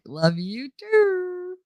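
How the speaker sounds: high, slow, drawn-out sing-song; mock sweetness. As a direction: stretch it out high with teasing sweetness. Sarcastic thanks to friend who scolded her.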